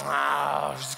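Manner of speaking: ordinary talk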